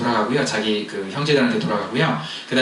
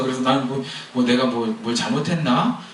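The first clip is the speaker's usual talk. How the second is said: normal talking voice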